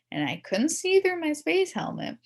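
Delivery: ordinary delivery, nothing marked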